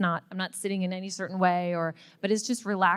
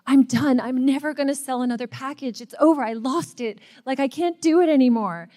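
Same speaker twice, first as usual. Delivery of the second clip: higher, louder replay of a tearful breakdown; retold despair; 6 semitones higher, 5 dB louder than usual. At a friend's house, retelling crying about never selling: higher, louder despair. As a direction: relive the meltdown, voice pitched up and raised. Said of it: "like, crying".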